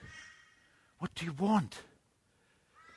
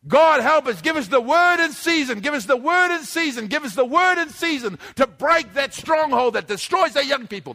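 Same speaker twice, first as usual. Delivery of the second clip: fervent prayer; 4 semitones higher than usual. Real, not acted. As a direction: cry out to God for help. Prayer at full force.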